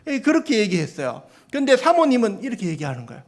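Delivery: ordinary talk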